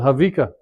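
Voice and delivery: "Havika" with a clear V in place of W; pronounced with a V. Shown as a demonstration. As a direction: say "Havika" as hah-VEE-kuh. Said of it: "some people pronounce it".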